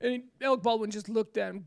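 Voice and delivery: normal talking voice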